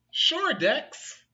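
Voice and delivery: high, loud friendly bear; cheerful bear voice. Acted. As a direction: answer brightly as the big bear. Mister Bear answers Dex.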